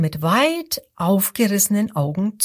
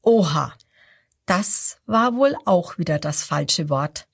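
ordinary delivery

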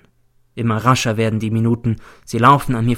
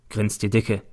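usual speaking voice

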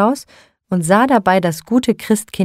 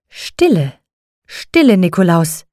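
firm, imperious hush, louder on the second stille; commanding; 3 dB louder than usual. A narrator's reading. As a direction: silence him with authority. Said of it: "sagte jetzt Frau Holle gebieterisch".

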